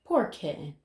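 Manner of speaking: plain reading voice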